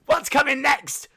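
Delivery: ordinary talk, nothing marked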